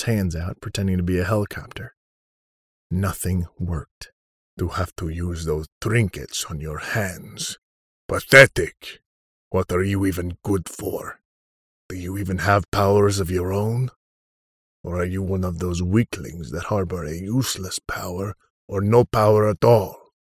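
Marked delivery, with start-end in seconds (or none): 4.6-7.6 scornful villain. Acted. Villain Odon taunts Sam. 8.1-9.0 a sudden furious bark; contemptuous shout. Acted. Villain sneers at weak hero. 9.5-20.1 scornful villain. Acted. Villain Odon taunts Sam.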